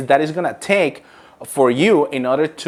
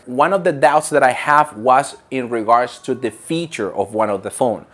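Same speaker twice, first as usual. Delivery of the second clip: usual speaking voice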